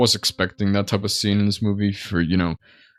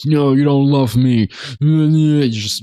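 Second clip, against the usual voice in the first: mock loud screaming, pitched up; Nikki; 6 semitones higher, 5 dB louder, much slower than usual. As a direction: imitate her screamed outburst, loud and high. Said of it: "just screaming very loudly".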